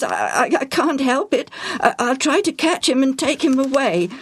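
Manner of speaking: high-pitched, flustered stammer; spluttering. A narrator's reading. Parrot's owner explaining himself to the family, spluttering. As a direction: trip over first sounds while explaining. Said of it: "Joel spluttered".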